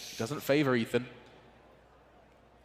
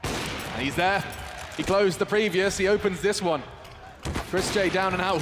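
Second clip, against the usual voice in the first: higher, louder play call; excited call; 8 semitones higher, 4 dB louder than usual. Real, not acted. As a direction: punch out the big moment. Caster calling a key kill.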